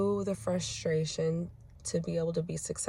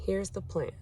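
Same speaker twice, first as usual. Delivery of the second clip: soft, measured and firm; calm steady plan; 5 dB quieter than usual. Retold or imitated. Mom giving her son a calm plan, softly firm. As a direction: lay out the plan gently but firmly. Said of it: "I said".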